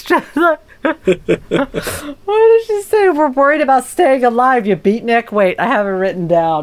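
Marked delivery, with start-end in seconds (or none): 0.0-3.1 asking through a laugh, high pitched; giggling while asking. Real, not acted. 3.1-5.3 a touch of exasperated lady, higher; mock fed-up socialite; exasperated Mrs Peacock. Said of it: "She calls him a beatnik".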